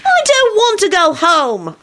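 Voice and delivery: put-on slow, high child's whine; whining protest. A narrator's reading. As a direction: drag out a childish refusal. Child whines at being sent home.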